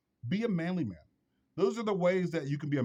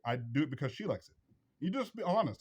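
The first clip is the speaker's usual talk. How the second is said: ordinary talk, nothing marked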